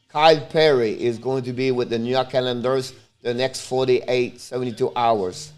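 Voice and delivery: ordinary delivery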